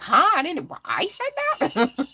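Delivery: high, slow, laughing huh I said that; a drawn-out, giggling double-take; mock baffled disbelief. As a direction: replay her own confusion with a laugh. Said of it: "I'm like".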